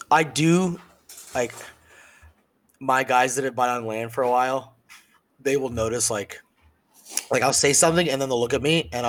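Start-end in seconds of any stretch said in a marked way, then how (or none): none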